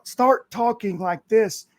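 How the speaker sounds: ordinary talk, nothing marked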